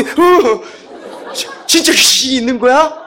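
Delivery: high, stammering mock alarm; startled. Young student sees a possessed person react, startled and stammering.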